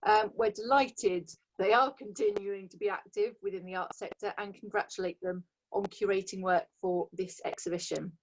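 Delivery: ordinary delivery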